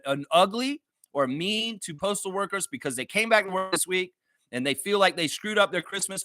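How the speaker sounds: normal talking voice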